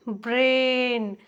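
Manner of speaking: Brain drawn out very slowly, "bre-en"; slow repeat. Shown as a demonstration. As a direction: stretch brain to show the ए sound. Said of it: "ए की ध्वनि आ रही है ना". (Brain बहुत धीरे, ए की ध्वनि खींचकर)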